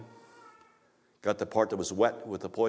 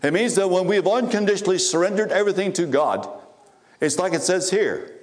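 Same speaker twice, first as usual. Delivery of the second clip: louder, higher, rising sermon climax; fiery preaching; 5 semitones higher, 4 dB louder than usual. Acted. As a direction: build it up like a preacher in full force.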